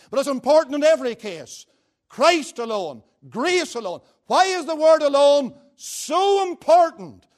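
high, loud pulpit delivery; full-force preaching. Real, not acted. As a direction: drive each phrase home, building to a loud finish.

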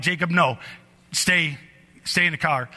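ordinary talk

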